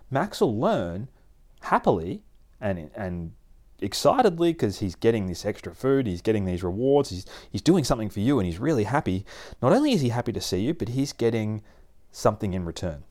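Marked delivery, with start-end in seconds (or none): none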